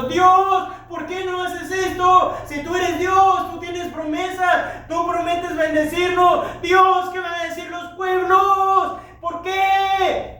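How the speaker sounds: high, loud, slow anguished cry; crying out to God. Acted. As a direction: plead with God in despair, nearly shouting. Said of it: "Josué estaba llorando". (Josué clamando a Dios con angustia)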